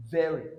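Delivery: "Very" with a buzzing V; stressed V in very. Shown as a demonstration. Teacher drilling "Very" with a V. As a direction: lean on the V of "Very". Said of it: "under the V sound".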